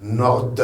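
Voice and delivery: "Nord" with its last consonant released; final D sounded. Shown as a demonstration. Teacher telling learners to pronounce it, D sounded. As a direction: let the end of "Nord" be heard. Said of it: "vous la prononcez".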